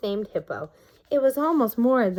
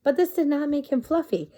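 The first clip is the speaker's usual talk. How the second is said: ordinary delivery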